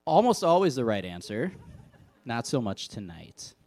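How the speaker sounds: ordinary delivery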